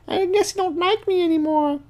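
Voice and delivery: high falsetto, hurt pout; sulky girl voice. Retold or imitated. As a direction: squeak it like a sulking teen girl. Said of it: "she's like".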